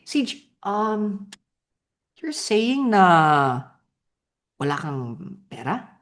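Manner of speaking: puzzled and slow, with hesitant pauses; confused tone. Shown as a demonstration. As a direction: sound like you can't quite believe it. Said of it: "the confused tone".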